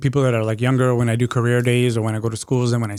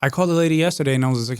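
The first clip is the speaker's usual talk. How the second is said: normal talking voice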